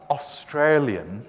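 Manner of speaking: "Australian" slow, every syllable fully sounded; over-enunciated Australian. Shown as a demonstration. Lecturer mocking careful speech to the class, over-enunciated. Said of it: "no one ever says Australian".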